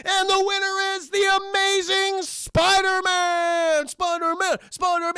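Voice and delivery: slow, high, loud winner announcement; announcer shout. Retold or imitated. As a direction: call out the champion to the crowd. Ring announcer declares winner.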